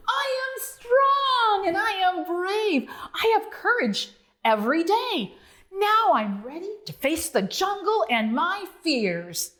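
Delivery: high, bold frog voice; bold shout. Acted. Bonzo on Duke's head, boldly declaring. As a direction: proclaim it proudly like a tiny hero. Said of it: "boldly shouted".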